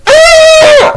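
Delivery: very high hammed-up scream; mock scream. Homie finds something at the party, mock screaming. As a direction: shriek like a horrified party guest.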